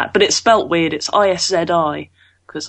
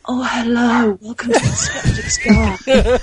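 higher, drawn-out sultry purr; sexy; 5 semitones higher, slower than usual. Acted. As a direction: overdo a seductive show intro. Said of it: "do a very sexy one".

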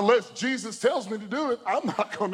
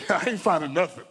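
laughing. Real, not acted. Laughing while retelling the panic.

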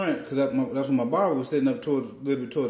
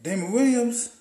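imitating barber; 7 semitones higher than usual. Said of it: "And he was like".

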